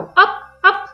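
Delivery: high, loud bouncy calls; happy calls. A narrator's reading. Toddler yelping as the pram bounces. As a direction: yelp on each bump. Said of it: "pokrzykiwał".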